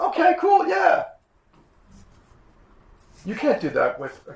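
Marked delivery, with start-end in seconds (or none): 0.0-1.0 put-on squeaky high child's reply; mock child's happy agreement; high kid voice. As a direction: answer like an eager little kid, way up high. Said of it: "you can say".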